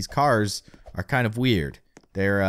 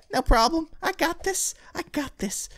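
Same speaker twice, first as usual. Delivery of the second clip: much higher, squeaky confident voice; high-pitched boast. Real, not acted. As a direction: squeak it out with bravado. Confident boast while jumping.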